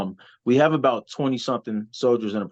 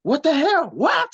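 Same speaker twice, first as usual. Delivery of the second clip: much higher, slow outraged mock yell; coach yelling at the ref; slower than usual. A wrestler imitating his coach yelling at the ref, outraged. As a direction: squeal it like a coach freaking out. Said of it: "he started yelling".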